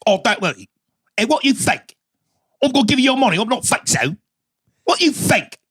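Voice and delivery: mock English accent. Podcast guest mimics the film's pub talk, mock English accent. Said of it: "I'm just this accent".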